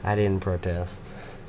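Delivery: ordinary delivery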